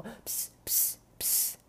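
soft unvoiced pssst; whisper. Whispering to friend with teacher right behind. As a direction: hiss quietly so the teacher behind won't hear. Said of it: "yo estaba susurrándola así como".

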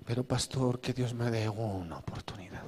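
soft whispered plea to the pastor; pleading whisper. Retold or imitated. Church member whispering a plea to the pastor. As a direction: whisper it like a begging confession.

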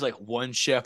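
ordinary talk

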